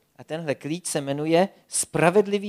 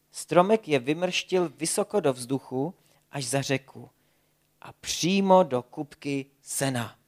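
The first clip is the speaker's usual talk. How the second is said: plain reading voice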